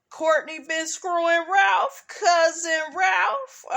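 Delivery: high, drawn-out scandalized question; mock shock. Gossiping about Courtney and cousin Ralph, mock shocked. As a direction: gasp at the gossip as if appalled.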